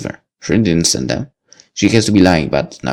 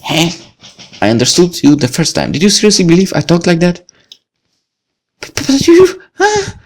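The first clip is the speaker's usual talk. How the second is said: put-on higher, stammering young woman; flustered Lucy; 10 semitones higher than usual. Lucy stunned he speaks normally, stammering higher. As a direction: caught out and flustered.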